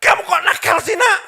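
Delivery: very high, loud scolding yell; imitating a mother screaming at her child; shouting mother. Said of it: "ibunya teriak".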